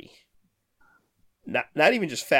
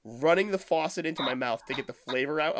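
ordinary talk

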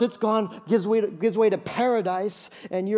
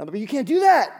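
higher and louder protest; loud mock protest; 5 semitones higher, 6 dB louder than usual. Pastor replaying his protest to the hotel clerk, loud and pleading. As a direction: protest loudly, half joking.

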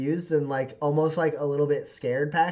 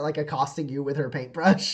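ordinary delivery

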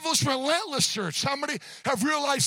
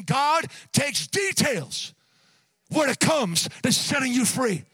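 loud, emphatic sermon climax; impassioned preaching; 2 semitones higher, 3 dB louder than usual. Real, not acted. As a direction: drive each phrase home to the congregation. Preaches at full force.

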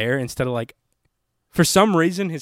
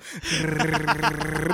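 rolling, purring drum noise; imitated tom drum; 3 semitones higher than usual. Describing the cat's noise, drum-roll trill. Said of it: "like a tom".

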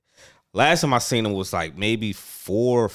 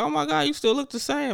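very high-pitched mock amazement; amazed relatives. Relatives seeing someone after years, mock amazement. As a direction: imitate a relative gushing, voice way up.

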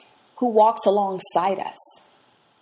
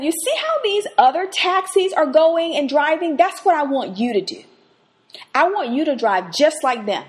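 louder, higher bossy instruction; pushy demand; 7 semitones higher, 4 dB louder than usual. Retold or imitated. Passenger ordering the taxi driver to speed, louder. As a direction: boss the driver around. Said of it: "But I said".

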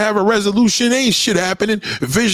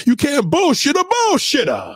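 raised, higher shout; 6 semitones higher than usual. Real, not acted. Ranting at bullshitting relatives.

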